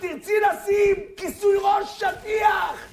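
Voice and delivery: fervent preaching rebuke. Acted. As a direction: chastise in a high preacher's cry. Rebukes woman in God's judgment. (תוכחה בדרשה בקול גבוה)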